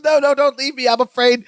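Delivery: half-hearted frightened wife begging him to stay; freaking out. Retold or imitated. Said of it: "Jennifer freaks out".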